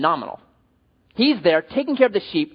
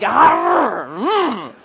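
high mock growl into a grunt; hammed-up snarl as he pounces; playful grunting roar; mock attack growl; 6 semitones higher, 4 dB louder than usual. Imitating lunging on the deer.

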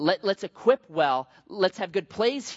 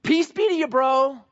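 much higher and louder, cheery; mock friendly wave; 8 semitones higher, 6 dB louder than usual. Driver cut off in traffic calling out a mock cheery blessing. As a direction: sing it out as a breezy blessing. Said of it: "Are you waving".

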